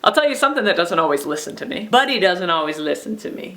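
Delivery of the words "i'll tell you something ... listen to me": ordinary talk, nothing marked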